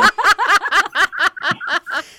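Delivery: loud, high burst of laughter; hearty laugh. Real, not acted. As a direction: let a big laugh break out. Laughs at own joke.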